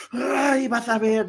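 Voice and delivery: high-pitched, seething threat; mock angry scolding. Kate scolding Meghan and Harry, mock furious. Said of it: "estaba ella muy enojada regañando a Meghan".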